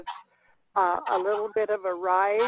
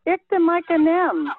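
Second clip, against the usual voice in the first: 1 loud model; 8 semitones higher, 6 dB louder than usual. Shown as a demonstration. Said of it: "Speak it like you would normally speak it".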